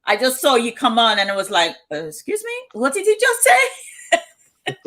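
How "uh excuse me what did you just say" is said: high, slow, drawn-out puzzled question; baffled. Retold or imitated. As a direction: play it exaggeratedly confused, pitch leaping up. Mock baffled reaction to Spanish video.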